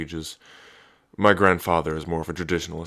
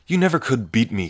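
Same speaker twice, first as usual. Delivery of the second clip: louder, higher cocky taunt; taunting challenge; 4 semitones higher, 5 dB louder than usual. Acted. Older brother taunting his little sister, loud and cocky. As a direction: goad her, daring her to try.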